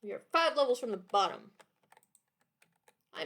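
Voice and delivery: usual speaking voice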